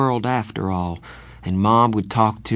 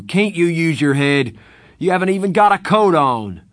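loud furious big-brother scolding; angry scolding; 6 semitones higher, 6 dB louder than usual. Acted. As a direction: rip into him at full volume. Darry scolding, shouting.

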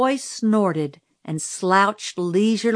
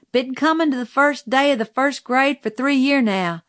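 flattered schoolboy boasting, pitched higher; expansive; 6 semitones higher, 3 dB louder than usual. A narrator's reading. Schoolboy tells teacher his first-grade record, expansively. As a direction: brag it out expansively, voice lifted. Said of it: "he said expansively".

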